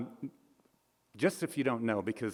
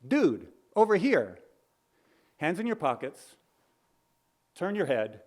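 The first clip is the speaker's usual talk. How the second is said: photographer's shout; 5 semitones higher than usual. Acted. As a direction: call out to a man on the red carpet. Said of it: "the photographer shouts".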